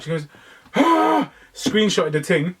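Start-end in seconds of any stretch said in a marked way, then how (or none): 0.7-1.3 sharp high-pitched mock gasp; retold gasp. Niece's excited reaction retold.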